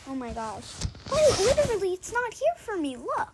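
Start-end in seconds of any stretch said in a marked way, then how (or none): 1.1-3.3 high, loud exasperation; frustrated at the game; exasperated. Real, not acted.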